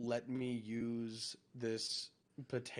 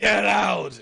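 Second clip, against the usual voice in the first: loud yell; shouting; 6 semitones higher, 13 dB louder than usual. Retold or imitated. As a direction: bellow it at the rats. Yelling at the rats.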